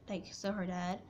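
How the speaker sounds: ordinary talk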